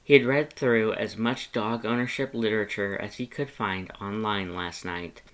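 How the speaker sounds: ordinary delivery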